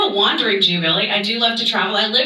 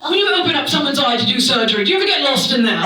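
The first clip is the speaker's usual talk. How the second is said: put-on quick, needling old-timey accent; Charlotte; 3 semitones higher, much faster than usual. Hostess needles the eye surgeon, old-timey disdain. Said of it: "And Charlotte says".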